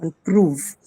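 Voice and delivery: "prove" stressed after a light "On"; stress on second syllable. Shown as a demonstration. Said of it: "the stress is on the second syllable".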